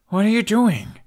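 loud, high frightened cry; scared cry. Acted. Hiccup on the dragon, as warriors close in, scared cry. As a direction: shout it out scared, not caring how loud. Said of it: "he cried".

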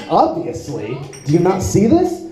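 normal talking voice

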